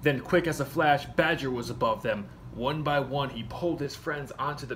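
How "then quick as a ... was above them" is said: ordinary delivery, nothing marked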